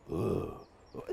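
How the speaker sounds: deep, quiet demon breaths; Clive impression breathing. Shown as a demonstration. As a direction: breathe low like Clive creeping. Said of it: "his best Clive impression".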